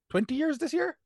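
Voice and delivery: high questioning rise; rising question. Real, not acted. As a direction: ask it, voice climbing. Unsure question to friend.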